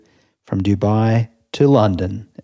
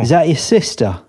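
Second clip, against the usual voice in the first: put-on Cockney accent; 7 semitones higher, 4 dB louder than usual. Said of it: "this guy said to me".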